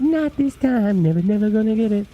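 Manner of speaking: high mocking nyah-nyah taunt; sing-song taunt. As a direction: gloat playfully. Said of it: "teasing taunt about butt".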